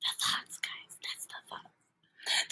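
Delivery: hushed, drawn-out whisper; whispered aside to the audience; playful whisper. Pretend. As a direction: whisper it slowly, barely any voice. Playfully repeats a phrase in whisper.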